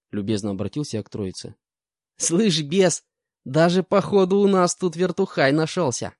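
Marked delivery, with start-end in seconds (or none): 2.2-6.1 put-on high, cocky young con; mock the new guard, pitched up; young thug jeering at the guard; young convict voice. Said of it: "обратился самый молодой".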